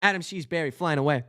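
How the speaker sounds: usual speaking voice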